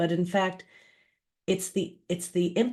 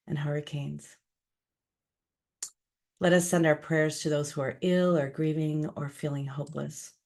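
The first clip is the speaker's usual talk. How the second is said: spoken prayer. Acted. Leading listeners in prayer for those suffering, calm and measured. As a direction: lead a calm, unhurried prayer.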